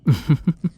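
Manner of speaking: light teasing chuckle; a short knowing laugh. Acted. Laughs at captive's request.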